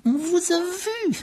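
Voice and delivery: très aigu, très lent, sifflé. A narrator's reading. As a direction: siffler chaque syllabe avec rage. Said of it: "siffla-t-il". (voix sifflante et menaçante)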